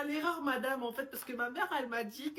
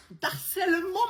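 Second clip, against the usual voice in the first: outraged cry; 5 semitones higher, 7 dB louder, slower than usual. Acted. Pupil outraged at accusation.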